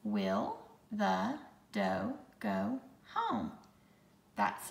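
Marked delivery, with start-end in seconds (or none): 0.0-3.5 each word set apart, slow; slow dictation, word by word; slow counting read. Shown as a demonstration. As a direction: count the words out slowly. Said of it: "I'm going to count the words".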